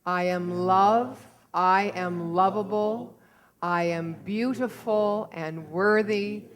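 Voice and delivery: slow, loud affirmation led for all; group affirmation. Real, not acted. Leading the congregation in saying it together, slow and strong. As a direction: lead the whole room through it, slow and strong. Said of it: "if you would now say that with me".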